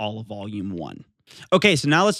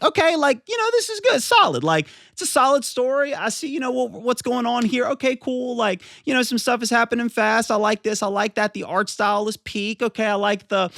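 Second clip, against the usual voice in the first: high-pitched reenactment of an easy first impression; upbeat approval; 10 semitones higher than usual. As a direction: rattle off approving thoughts in a raised voice. Acting out his first casual approval.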